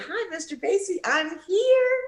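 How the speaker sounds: put-on high, bright-eyed young girl's hello; eager greeting. Young singer greeting her new bandleader, high and eager. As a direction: chirp it up high like an eager kid.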